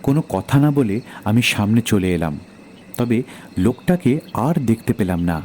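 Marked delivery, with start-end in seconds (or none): none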